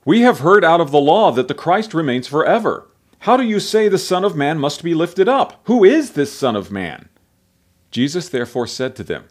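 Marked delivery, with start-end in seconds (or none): 0.1-7.1 loud, raised-pitch challenge; multitude answering. A narrator's reading. The multitude questioning Jesus about the Son of Man, loudly challenging. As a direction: call the questions out as a crowd would. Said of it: "The multitude answered him".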